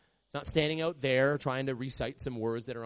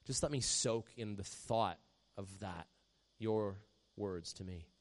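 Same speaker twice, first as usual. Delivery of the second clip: hushed meditative awe; 4 semitones lower, 7 dB quieter than usual. Shown as a demonstration. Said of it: "meditate on it and go".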